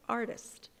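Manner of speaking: usual speaking voice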